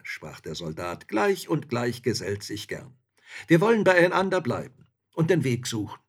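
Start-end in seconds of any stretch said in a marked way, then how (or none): 1.1-6.0 lively soldier, higher and louder; hearty, sociable soldier's voice; jovial soldier. A narrator's reading. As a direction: speak up as a chummy soldier. Soldier figure in a story.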